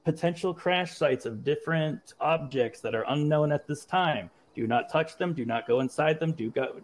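normal talking voice

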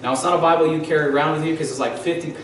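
normal talking voice

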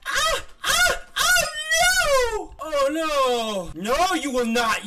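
high, loud screams of panic; panicked screaming. Real, not acted. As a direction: scream as it all goes wrong. Raging at hard game.

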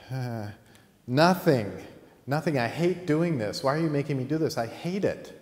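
put-on high-pitched childish outburst; exasperated protest. Girl to grandfather who makes her do this, exasperated protest.

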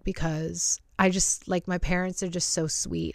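ordinary talk, nothing marked

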